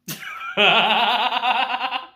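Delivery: loud, high cackling laugh; real laugh bursting out loud; burst of laughter. Real, not acted. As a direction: crack up laughing. Cracking up at own retelling.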